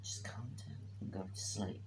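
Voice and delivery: hushed unvoiced whisper from the bed; whispered retort. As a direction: whisper it so as not to wake anyone. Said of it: "She went".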